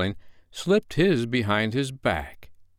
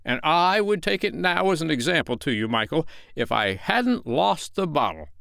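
mildly brave boasting; 8 semitones higher than usual. A narrator's reading. Father boasting to Michael as an example, bravely. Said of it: "Mister Darling said bravely".

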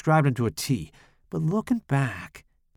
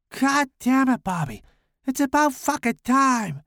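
put-on girlish whisper; 13 semitones higher, 3 dB louder than usual. A narrator's reading. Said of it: "he said in a girlish whisper".

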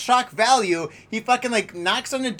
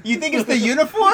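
normal talking voice

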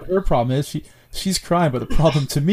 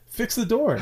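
higher, mock-insistent order; mock exasperated demand; 9 semitones higher, slower than usual. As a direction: pitch it up like a nagging partner. Exasperated demand about door.

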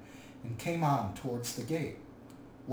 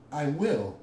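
firm, louder, grim assent; Thorin, grim; 2 semitones higher, 5 dB louder than usual. A narrator's reading. Dwarf agreeing to let the hobbit go, grim and firm. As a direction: answer grimly, with force. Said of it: "said Thorin grimly".

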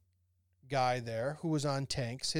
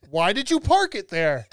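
imitated Mahmoud; 4 semitones higher, 9 dB louder than usual. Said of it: "And I would say".

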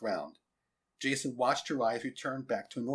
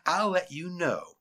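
slow, smug drawl after a chuckle; lightly gloating schoolboy; 6 semitones higher, 4 dB louder, slower than usual. A narrator's reading. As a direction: lord it over her, taking your time. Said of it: "said a gloating Jason".

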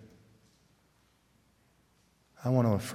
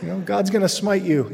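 mock scolding kid; 7 semitones higher than usual. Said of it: "I'd be like".

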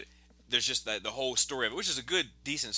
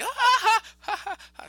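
very high imitation laugh; mock laughter; 8 dB louder than usual. Rabbit over the fire starts laughing, high mock laugh. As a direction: burst into high, staged ha-ha-ha. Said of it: "then he starts laughing".

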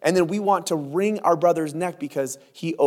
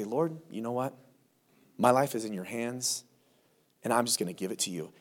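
soft, low prayer; hushed, trusting address to the Lord; calm surrender; 4 semitones lower, 8 dB quieter than usual. Acted. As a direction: hand it over quietly to God. Acted prayer of surrender after stepping back.

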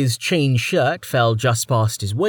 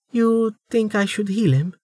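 higher, light female voice, asked quietly; soft question from a young woman; 10 semitones higher than usual. Acted. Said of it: "Amelia asked quietly".

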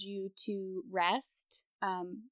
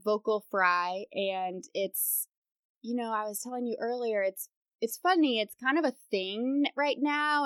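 usual speaking voice

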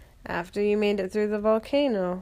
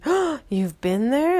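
higher, louder, slow amazed question; surprised; 6 semitones higher, 9 dB louder than usual. Acted. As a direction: gasp it out in amazement. Tigey surprised at the troll.

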